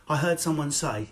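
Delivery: usual speaking voice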